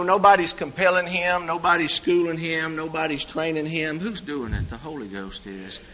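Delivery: normal talking voice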